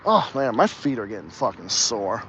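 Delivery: ordinary delivery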